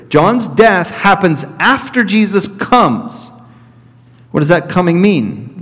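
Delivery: ordinary talk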